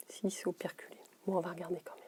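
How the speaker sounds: whispered aside to herself; a soft whispered sigh of defeat; disappointed whisper. Real, not acted. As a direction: let the letdown slip out under your breath. Finds the tube sealed, mutters aside.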